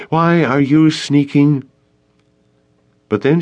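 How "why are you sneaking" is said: high, slow indignant question; crying out. A narrator's reading. Jenna confronts Traven for sneaking behind them, crying out. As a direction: challenge him in a raised pitch. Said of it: "Jenna cried".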